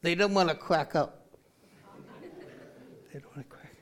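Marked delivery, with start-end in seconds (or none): 3.1-3.8 whispered aside repeating the punchline. Acted. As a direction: mutter the punchline under your breath, voiceless. Puppet repeats punchline to itself.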